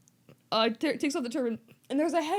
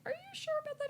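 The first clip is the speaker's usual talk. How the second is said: high squeaky Harry; 10 dB quieter, much faster than usual. Retold or imitated. Harry voiced in retold story.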